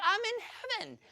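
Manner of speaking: put-on teen in Austria, blissful and laughing; delighted gush. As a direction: gush with joy.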